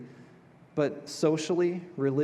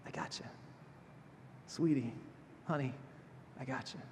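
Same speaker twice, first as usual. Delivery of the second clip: barely audible, tender reassurance; gentle comfort; 11 dB quieter than usual. Retold or imitated. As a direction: hold them close and murmur softly. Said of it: "walks up to you calmly and gently".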